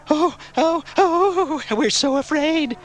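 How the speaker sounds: very high falsetto mock fear; laughing mockery. A narrator's reading. Biker taunting Garth's threat, laughing in falsetto mock fear. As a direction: jeer in a squeaky, pretend-scared voice. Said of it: "laughed one of the bikers".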